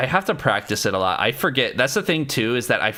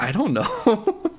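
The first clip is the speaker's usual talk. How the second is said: high, laughing helpless answer; slow, squeaky-high laughing protest; retold cluelessness; 11 semitones higher, slower than usual. Answering a puzzled ex in a retelling, high laughing cluelessness. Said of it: "And I was like".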